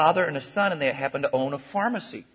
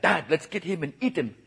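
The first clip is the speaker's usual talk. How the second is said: lightly voiced eager son, a bit higher; slightly raised joke-character voice; 4 semitones higher than usual. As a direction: play a keen boy cannibal urging Dad on. Son's line in cannibal joke.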